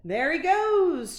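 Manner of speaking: high, loud, slow cry; excited shout. A narrator's reading. Vice Principal Tanner spotting the dog. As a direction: point and shout. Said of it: "shouted Vice Principal Tanner".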